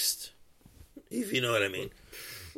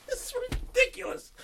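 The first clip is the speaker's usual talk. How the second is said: mock crying; 8 dB quieter than usual. Mock-crying rant about the movie.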